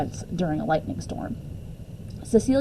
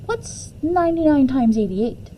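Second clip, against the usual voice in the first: grandma quizzing; 6 semitones higher than usual. Retold or imitated. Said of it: "she asked".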